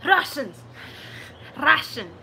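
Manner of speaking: loud, punchy mock Russian accent; exclaimed in hammed-up Russian accent. Shown as a demonstration. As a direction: call it out with a thick Russian accent. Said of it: "what to say in Russian".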